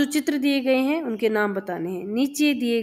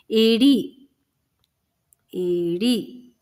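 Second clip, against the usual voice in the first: dictation; much slower than usual. Shown as a demonstration. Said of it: "इस तरह से लिखेंगे".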